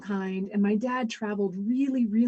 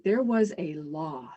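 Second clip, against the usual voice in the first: usual speaking voice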